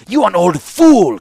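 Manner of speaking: loud, high, slow insult; contemptuous sneer. A narrator's reading. Young ruler insulting the warlord amid chaos at the table, sneering. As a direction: pour open contempt on him. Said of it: "with a contemptuous sneer".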